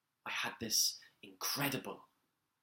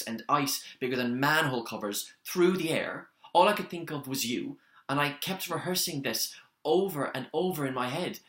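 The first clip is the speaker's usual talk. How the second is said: dramatic storm tale; 5 dB louder than usual. Acted. Recounting a deadly Everest storm to Brian, building loud. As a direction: raise the voice as if over the wind.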